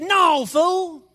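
high, loud mock rebuke; joking outburst. Preacher jokingly putting words in Jesus's mouth, high mock rebuke. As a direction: blurt it loudly as a joke.

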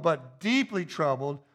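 usual speaking voice

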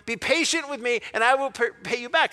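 high, loud, urgent plea; begging. Acted. Fellow servant to his creditor, begging for time. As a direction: plead earnestly, voice lifted. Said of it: "begged him".

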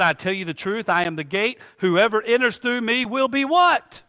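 usual speaking voice

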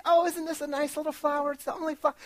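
high, far-off dreamy friend voice; imitating Shelly. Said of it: "And she's like".

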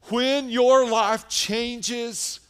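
loud, high, driving sermon voice; fiery revival preaching; preaching at full force. Acted. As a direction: preach it out to the whole church, pressing hard.

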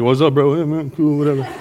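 low, offhand slacker voice; casual teen. Pretend. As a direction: toss it off without even looking. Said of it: "just like".

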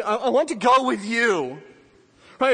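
loud, ringing sermon voice; preaching at full force. Acted. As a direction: drive it home to the congregation. Preaching climax, raised voice.

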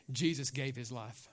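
low, soft and grave; hushed statement. Shown as a demonstration. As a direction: say it low and softly. Line given to congregation to repeat.

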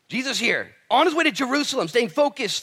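loud, high, ringing sermon voice; full-force preaching. Real, not acted. Preacher driving the point home to his congregation, loud and high. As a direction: preach it out to the whole room.

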